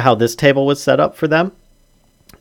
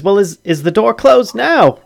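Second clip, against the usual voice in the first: high, hesitant worried question; worried halfling; 10 semitones higher, 4 dB louder than usual. Acted. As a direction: ask nervously, voice pitched up. Halfling worried about door to sewer.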